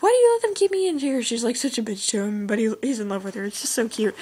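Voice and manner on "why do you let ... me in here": a high, quick, lightly voiced demand; haughty prisoner girl. Vlogger voicing her story's prisoner to her guard, high and quick. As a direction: play the captive princess snapping at her guard, pitched up. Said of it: "And she's like".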